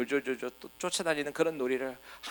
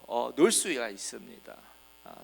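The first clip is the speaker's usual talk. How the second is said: ordinary delivery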